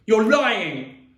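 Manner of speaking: loud, high angry yell; screamed accusation. A narrator's reading. Boy screaming at his sister, enraged. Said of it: "I screamed at Via".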